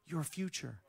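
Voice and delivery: soft, low pleading, slowed down; hushed plea. Acted. Father warning his son away from a destructive path, hushed plea. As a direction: plead quietly, almost under your breath.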